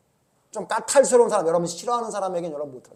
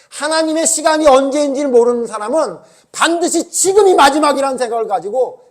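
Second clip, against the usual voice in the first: loud, higher, driving sermon voice; fervent preaching; 8 semitones higher, 12 dB louder than usual. Acted. Preacher scolding the congregation, loud fervent preaching. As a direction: press the point hard to the congregation.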